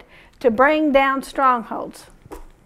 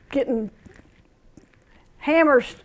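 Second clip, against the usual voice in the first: ordinary talk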